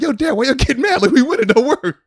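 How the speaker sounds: put-on high, loud kid voice; happy little son. Son reassuring worked-up dad, happy and loud. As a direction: chirp up at dad like a carefree child.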